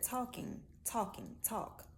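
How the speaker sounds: talking said with soft dropped g, talk with open aw; southern talk. Shown as a demonstration. Speaker showing viewers her own pronunciation, talking, talking, talk. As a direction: say talking, talking, talk the relaxed Miami way. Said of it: "notice how I said that".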